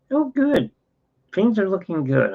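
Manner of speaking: high, sing-out "oh" easing downward; nurse's reassurance. Retold or imitated. Nurse checking on a patient in hospital, high imitated reassurance. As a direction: lightly imitate an upbeat nurse, pitched well up.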